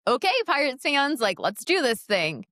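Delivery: high-pitched mock presenter greeting, easing down; retold on-air pep. Reporter going live after crying, putting on upbeat pep. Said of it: "mood you put to the highest of highs".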